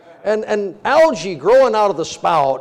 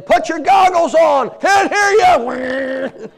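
loud yell from across the yard, higher; wife yelling; 8 semitones higher, 6 dB louder, slower than usual. Retold or imitated. Wife yelling from the house over the saw. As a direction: shout it over the power saw. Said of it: "yelling and saying".

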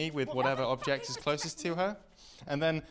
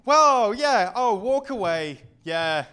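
louder, higher boastful jeer; mock swagger; 5 semitones higher, 5 dB louder than usual. Comedian boasting as the girlfriend walks out, loud swagger. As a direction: strut and brag at her. Mocks her walking away.

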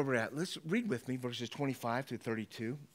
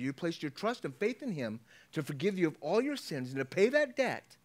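ordinary talk

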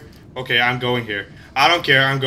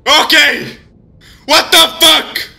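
startled shout; 9 semitones higher, 4 dB louder than usual. Real, not acted. Startled in horror game.

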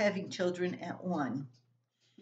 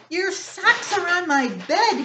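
high, loud bellow at his brother; big brother bellowing over socks; 11 semitones higher, 9 dB louder than usual. Acted. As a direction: bellow it across the bedroom. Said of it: "he bellowed".

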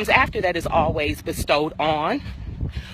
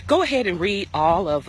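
ordinary talk, nothing marked

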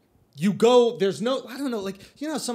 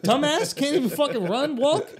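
much higher, louder indignant yell; mock angry shout; 10 semitones higher, 5 dB louder than usual. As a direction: snap back in mock outrage. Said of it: "I'm like".

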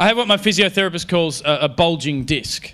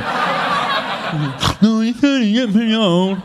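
higher, slurred, garbled mock voice; slurred mocking taunt; speech impediment impression; 7 semitones higher, slower than usual. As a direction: mangle the words like a dopey friend. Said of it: "my friends with massive speech impediments".

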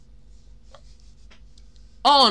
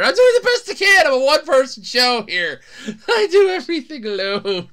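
high, loud, laughing plea; laughing protest; 6 semitones higher, 7 dB louder than usual. Real, not acted. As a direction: protest loudly through laughter. Pleads overwork while laughing.

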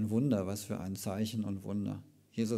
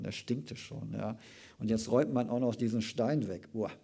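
ordinary talk, nothing marked

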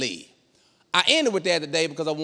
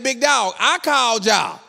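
high, loud comic outrage; indignant protest; 7 semitones higher, 4 dB louder than usual. Retold or imitated. Husband to police he called, loudly indignant.

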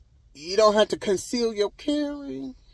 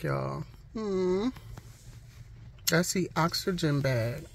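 ordinary delivery

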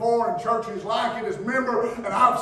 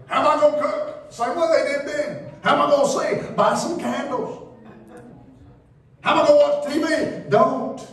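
high whiny questions, flat answers; mock fretting questions with curt replies; mock complainer and answers; 5 semitones higher than usual. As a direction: play the fretting member against the preacher. Acted exchange, complainer and preacher.

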